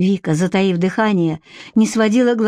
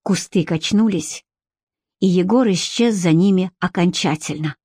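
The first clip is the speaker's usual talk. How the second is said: ordinary delivery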